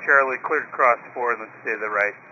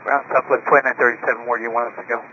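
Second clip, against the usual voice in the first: crackly radio transmission; radio static bursts; 10 semitones lower, 4 dB quieter, much faster than usual. Real, not acted. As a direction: pilot heard over the radio. Pilot radio call.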